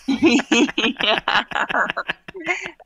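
a long bright burst of real laughter; laughing burst. Real, not acted. Guest bursts out laughing at aerial-or-Portuguese question, real laugh. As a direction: crack up at the silly question.

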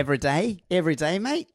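hammy Australian, lifting into a question; attempted Aussie accent. Shown as a demonstration. Host echoing the guest in an exaggerated attempted Aussie accent. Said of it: "I can't do it".

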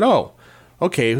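usual speaking voice

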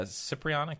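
"Cipriana" with a soft s, "sip"; trial pronunciation. Shown as a demonstration. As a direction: start "Cipriana" like "sip", trying it out. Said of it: "How would you say that?".

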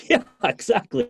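high, laughing agreement. Retold or imitated. Friend laughing at the throw.

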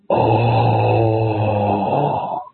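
imitating a choked-up, awestruck old wrestler; a low, moved "oh" as if staring at the photo; hushed, emotional sigh of recognition; Kobashi's awed oh. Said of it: "And then he just went".

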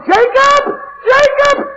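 high calling shout across a field; hollering. Retold or imitated. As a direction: call out his name from far away. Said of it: "they begin to holler".

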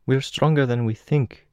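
put-on high-pitched Russian-accented girl; gentle, high Russian-accented voice; soft Russian accent. A narrator's reading. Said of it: "her voice soft".

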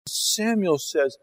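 ordinary talk

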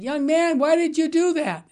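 a hint of a puzzled old lady; old woman's question. Elderly lady in restaurant asking the young man, lightly voiced. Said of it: "the woman said".

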